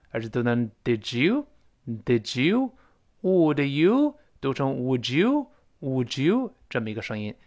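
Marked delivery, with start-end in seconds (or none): 0.8-6.5 "did you" and "would you" slowed right down, d and y blended into a j sound; linked did you. Shown as a demonstration. Teacher slows down to show students the linked did you sound. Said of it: "所以不是did you，而是读成".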